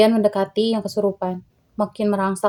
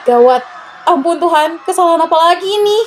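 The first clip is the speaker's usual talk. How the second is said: panicked; 8 semitones higher, 5 dB louder than usual. Acted. Panic as possession spreads.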